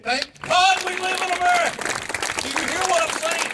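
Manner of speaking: veteran rallying the crowd after their amens, roaring it out; fervent shout. Real, not acted. Speech repeats line, crowd says amen.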